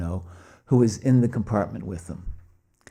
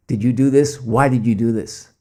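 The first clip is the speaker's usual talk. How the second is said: louder, pressing police questions; police interrogation; 2 semitones higher, 7 dB louder than usual. Retold or imitated.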